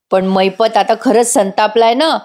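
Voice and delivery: usual speaking voice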